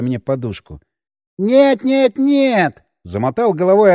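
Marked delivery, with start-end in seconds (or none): 1.4-2.8 high, jittery voice of a scared simple man; frightened Albertik. Acted. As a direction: shake him out of it, high and pleading.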